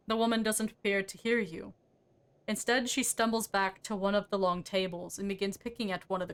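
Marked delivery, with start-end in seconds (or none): none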